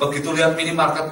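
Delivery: ordinary delivery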